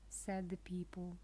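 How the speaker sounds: usual speaking voice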